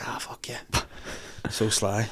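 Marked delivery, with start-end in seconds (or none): 0.1-0.6 breathy whispered aside. Retold or imitated. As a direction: whisper it under your breath. Jesus on cross, muttered.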